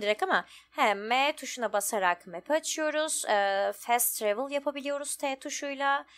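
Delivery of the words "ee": ordinary delivery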